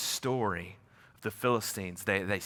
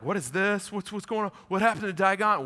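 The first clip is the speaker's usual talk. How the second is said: Philistines baffled; 8 semitones higher than usual. Acted. Philistines voiced finding fallen idol.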